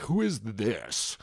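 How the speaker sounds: slow, drawn-out clueless question; a lightly voiced confused fan; mock puzzled fan. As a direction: mimic a baffled viewer, slowly. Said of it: "all of Queso's fans are like".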